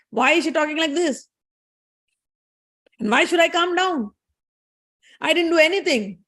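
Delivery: high-pitched indignant self-defence; angry Brahmaji. Retold or imitated. Brahma refusing to calm down, angry. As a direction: act out an offended husband protesting. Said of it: "Brahmaji is also angry".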